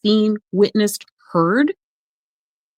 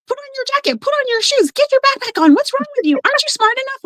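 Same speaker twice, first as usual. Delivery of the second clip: mocking nagging parent, pitched way up; much faster than usual. Coach mocks a scolding parent barking orders, high nagging voice. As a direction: rattle off orders like an exasperated parent. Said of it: "'Cause that's like".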